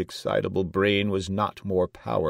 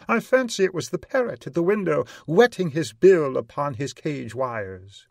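high, loud, quick courtier; 9 semitones higher, 7 dB louder than usual. A narrator's reading. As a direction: chatter out a theory to the king. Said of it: "observed one of the courtiers".

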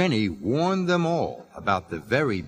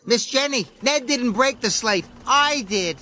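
urgent shout; 12 semitones higher than usual. Acted. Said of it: "Jack shouted".

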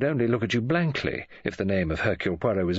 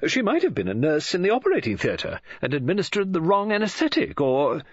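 high-pitched, carried off by her own theory; well away; 9 semitones higher, 3 dB louder than usual. A narrator's reading. Novelist keeps inventing past the detective's protest, carried away. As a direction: race on eagerly into the next guess, then break off. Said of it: "Missus Oliver was well away".

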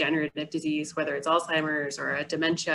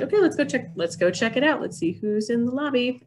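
acted cheerful caregiver; 6 semitones higher than usual. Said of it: "being like".

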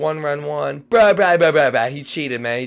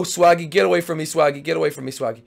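ordinary delivery

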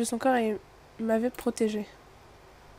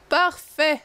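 a higher, louder satisfied Parfait; pleased; 5 semitones higher, 4 dB louder than usual. Real, not acted. Route found, she exclaims Parfait with higher, louder satisfaction. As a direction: declare it done with relish.

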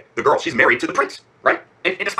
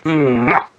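loud smacking goodnight kiss; big kiss sound; 5 dB louder than usual. Real, not acted. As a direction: plant a noisy kiss.

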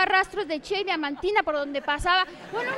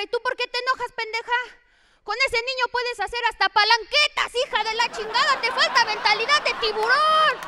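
higher, brash mock scolding of the teacher; cheeky scolding; 6 semitones higher, 4 dB louder than usual. The comic scolding the angry teacher, higher and brash. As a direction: tease the teacher with swagger. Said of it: "Le digo a la maestra".

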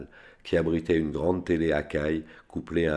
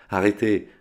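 a loud, higher, choked-off cry; strangled cry; 5 semitones higher, 6 dB louder than usual. A narrator's reading. Banker crying out as his heroin is dumped, strangled shout. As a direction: yell it as if your throat tightens. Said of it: "cria Anton Birch d'une voix étranglée".

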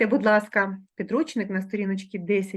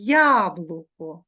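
яблуко drawn out very slowly, syllable by syllable; slow example word; 3 semitones lower, 4 dB louder, much slower than usual. Shown as a demonstration. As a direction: stretch яблуко slowly for the class. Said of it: "Наприклад, у слові".

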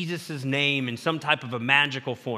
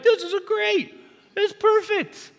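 much higher, wide-eyed delighted voice; delighted kid. Retold or imitated. Preacher voices Josh discovering the wrench fits, delighted and high. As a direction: play a boy thrilled by a wrench that fits. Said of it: "And you're like".

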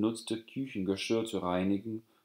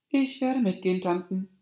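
put-on much higher, clear girl's voice; girl calmly answering mocking aunts, much higher; 11 semitones higher, 4 dB louder than usual. A narrator's reading. Calm girl ends the laughter.

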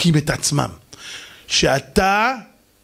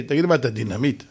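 ordinary talk

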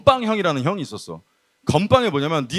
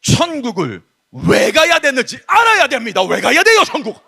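swelling into loud, higher declamation; old preacher's oratory; 4 semitones higher, 4 dB louder than usual. Acted. Youth preacher imitates the old pastor raising the tension, loud and high. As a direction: build the same question into a booming demand. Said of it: "높낮이 바꾸고 텐션을 바꾸는 거야".

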